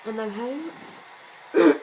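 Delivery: slow, hoarse, losing her voice. Real, not acted. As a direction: croak it out with a tired voice. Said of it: "as you can hear, I'm having a bit of trouble speaking".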